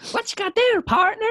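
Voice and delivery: teasing imitation of a bad Southern American accent; mock Southern American. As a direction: squeal it in a loud, high Southern American parody. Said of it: "You said".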